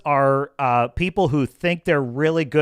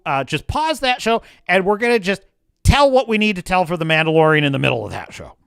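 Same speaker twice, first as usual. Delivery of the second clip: ordinary talk, nothing marked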